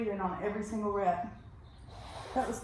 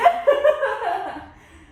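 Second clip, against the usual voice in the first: loud laugh; 10 semitones higher, 9 dB louder than usual. Real, not acted. Laughs at partner's reaction.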